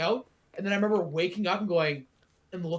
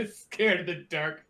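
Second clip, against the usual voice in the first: mocking tease. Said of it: "Asshole, what the fuck?".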